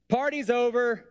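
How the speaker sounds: shout-sing 'Party's over' high to the room, hammed up; high, theatrical 'Party's over'; high mock announcement. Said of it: "he just said".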